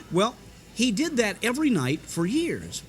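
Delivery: usual speaking voice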